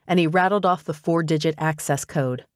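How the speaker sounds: ordinary delivery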